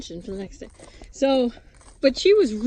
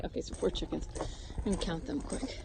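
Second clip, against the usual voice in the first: whispered count so the birds stay calm; 2 semitones lower, 12 dB quieter than usual. Real, not acted. Woman whispering in the dark coop so chickens sleep. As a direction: hush it under your breath.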